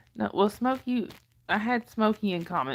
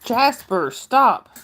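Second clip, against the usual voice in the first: loud, high call to stop the dog; sharp yell at dog; 4 semitones higher, 10 dB louder, much slower than usual. Real, not acted. Owner yells at the dog to stop, loud and sharp. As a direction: stop the dog right now, loudly.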